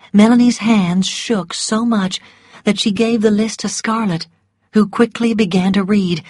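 usual speaking voice

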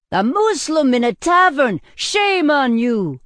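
loud, high, scandalized shout; outraged roar. A narrator's reading. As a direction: roar your condemnation at the sinner. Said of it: "the man roared".

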